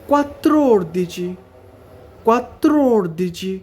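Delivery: "quattordici" slowed, each syllable spaced out; slow syllable drill. Shown as a demonstration. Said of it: "ভালো করে এগুলোর উচ্চারণটা খেয়াল রাখতে হবে".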